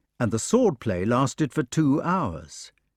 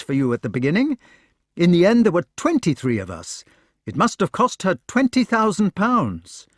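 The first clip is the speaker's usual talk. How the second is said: much higher, louder, tumbling out; astonished eager questions; 8 semitones higher, 4 dB louder than usual. Acted. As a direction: press him with amazed questions. Knight presses with questions.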